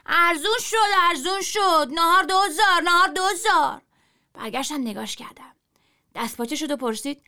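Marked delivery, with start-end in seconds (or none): 0.1-3.8 high, loud cheap-lunch cry; hawking shout. A narrator's reading. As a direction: Qader crying out the bargain. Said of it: "قادر شروع کرد به داد زدن". (جار بلند قادر برای ناهار ارزان)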